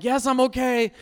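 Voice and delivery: loud, high yelp of reassurance; mock startled yell; startled shout. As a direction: jolt awake and call through the door. Startled awake in the shower.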